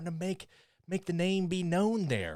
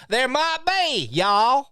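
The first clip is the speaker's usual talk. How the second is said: high exaggerated Southern twang, louder; Southern accent imitation; 10 semitones higher, 10 dB louder, much slower than usual. Teasing a co-host for slipping into a Southern accent. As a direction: ham up a Southern drawl on y'all. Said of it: "you're going Southern".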